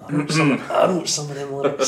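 a quick, cheeky teasing voice; mock itching bandmate. Ribbing a bandmate about the lyrics, mock protest. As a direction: tease him as if he's dying to claim credit. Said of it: "itching, going".

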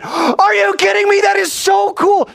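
a friend beside himself with glee; thrilled Jesus. Retold or imitated. Jesus cheering Peter's catch, thrilled.

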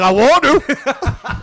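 high, slow, laughing plea; mock pleading friend. Comedian imitating a clingy guy begging to meet the kids, high laughing whine. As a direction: stretch it out high through a laugh. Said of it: "He's like".

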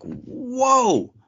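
high, drawn-out stunned whoa; shocked whoa. Retold or imitated. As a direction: react in disbelief. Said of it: "I'm like".

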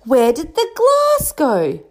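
high, slow stunned disbelief; shocked. A narrator's reading. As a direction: gasp it out in shock. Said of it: "the keeper was in shock".

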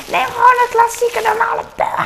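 high, shrieking order; mock shriek. Wife shrieking at her indecisive husband to order plain, high. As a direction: shriek it at him, exasperated. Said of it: "gil ik altijd".

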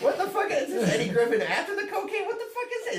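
high-pitched incredulous outburst; mock disbelief climbing into a squeak; laughing, hammed-up bewilderment; baffled customer. Imitates baffled customer getting drink.

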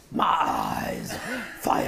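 hushed churchy preacher voice. Shown as a demonstration. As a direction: drop into a breathy preaching whisper. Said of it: "that preacher voice".

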